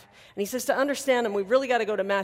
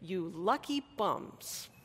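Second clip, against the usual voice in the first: slow, wry, affectionate "You lucky bums"; playful aside; 5 dB quieter, much slower than usual. Retold or imitated. Pastor quoting Karl Barth's translation, slow and wry. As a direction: drawl it out like a teasing pat on the back. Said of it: "He says that we should translate it".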